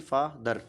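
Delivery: "फादर" split into two beats; syllable by syllable. Shown as a demonstration. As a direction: say "फादर" with a short break after the first syllable. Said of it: "बोलेंगे कि नहीं बोलेंगे". ("फादर" को दो हिस्सों में तोड़कर)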